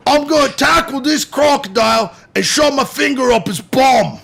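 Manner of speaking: loud, high Aussie attempt turning angry New Yorker; botched Australian accent. Shown as a demonstration. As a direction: shout it in a bad Australian accent. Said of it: "sounds like an angry New Yorker".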